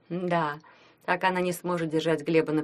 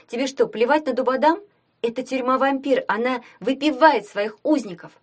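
higher, louder, urgent protest; agitated; 7 semitones higher, 5 dB louder than usual. A narrator's reading. Tanya warns of the vampire prison, agitated. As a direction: push it out high and forceful. Таня argues in alarm.

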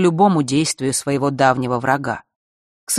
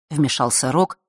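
ordinary delivery, nothing marked

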